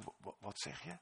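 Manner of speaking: soft, straining to hear; whispered stammering what; hushed question. Acted. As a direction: ask quietly, leaning in.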